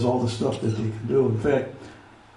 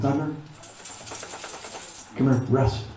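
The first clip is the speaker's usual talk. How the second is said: dog command; 6 dB quieter than usual. Shown as a demonstration. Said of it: "I'll give him the command".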